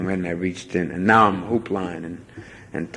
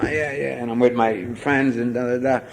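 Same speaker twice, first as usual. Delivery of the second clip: normal talking voice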